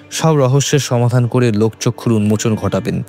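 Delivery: ordinary delivery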